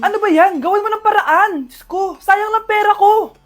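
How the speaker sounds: acted irate passenger; upset complaint. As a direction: complain indignantly to airline staff. Passenger misses flight, complains at agent.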